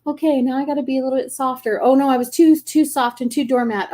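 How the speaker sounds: mock dismay. Acting out swinging between extremes.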